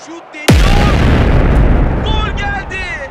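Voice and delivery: loud, rising goal call; screaming football commentator. Acted. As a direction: shout the goal to the stadium.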